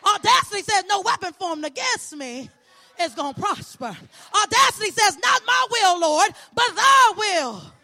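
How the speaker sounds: fervent preacher's cadence, rising and falling; preaching. Acted. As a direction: preach it to the congregation with conviction.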